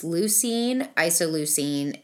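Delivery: ordinary delivery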